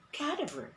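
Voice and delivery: CADAVER with stress on the first syllable, KAD-uh-ver; common mispronunciation. Shown as a demonstration. Teacher showing viewers what most people say, common mispronunciation. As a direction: punch the first syllable of CADAVER, glide the rest. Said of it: "that is not the pronunciation".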